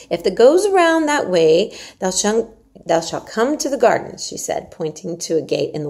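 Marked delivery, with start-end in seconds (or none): 0.1-4.2 Yorkshire maid Martha. Acted. Martha urges Mary to eat her porridge, hearty and coaxing. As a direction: coax the child like a bossy country servant.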